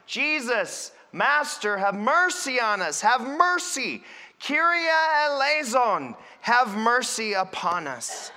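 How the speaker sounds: lepers' loud, rising plea for mercy; crying out pleadingly. Acted. Leper calling to Jesus as he draws near, crying out. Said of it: "we cried out, we cried".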